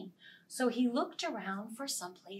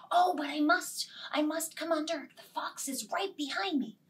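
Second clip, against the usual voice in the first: higher urgent plea; urgent rabbit; 6 semitones higher, faster than usual. Acted. Rabbit insisting, fox behind. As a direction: insist in a hurry.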